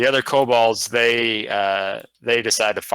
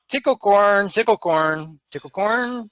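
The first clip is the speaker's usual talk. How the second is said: a higher kobold voice calling the password out; 9 semitones higher than usual. Acted. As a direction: call the password out ahead of the group like an eager kobold. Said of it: "Meepo is yelling out".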